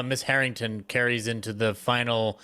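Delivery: ordinary talk, nothing marked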